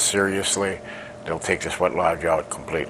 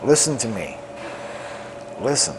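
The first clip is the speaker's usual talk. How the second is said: solemn poem recital; 3 semitones higher than usual. Acted. Lakota elder recites his poem to the world, solemn declamation. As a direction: proclaim each line like a chant to the people.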